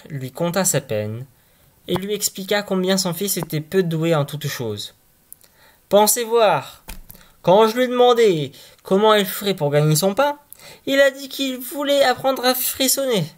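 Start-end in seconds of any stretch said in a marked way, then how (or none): 5.9-13.3 higher, fed-up father complaining; father telling the sexton his troubles; exasperated father. Acted. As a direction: vent your worry about the boy.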